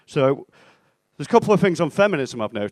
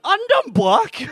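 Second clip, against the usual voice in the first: high, loud, drawn-out punchline cry; mock exclamation; 7 dB louder, slower than usual. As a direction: blurt it out slowly in a soaring, loud voice. Friend caps the joke while laughing.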